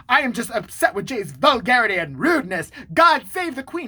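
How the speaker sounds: hammed-up British indignation, rising loud and high; mock an offended Brit, building to a proclamation; mock British accent. Imitating American friend's affected Britishness, mock British accent. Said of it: "He's fucking American, by the way".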